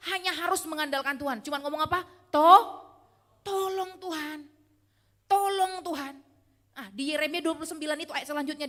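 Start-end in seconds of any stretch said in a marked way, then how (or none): 2.3-6.1 high, drawn-out pleading cry to God; desperate plea. Pretend. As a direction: beg God for help, stretching the words. (memohon dengan putus asa, nada tinggi)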